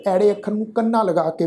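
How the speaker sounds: ordinary delivery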